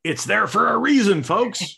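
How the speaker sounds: loud, high call to the crowd; mock proclamation. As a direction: proclaim it playfully to the whole audience. Mock announcement to the audience.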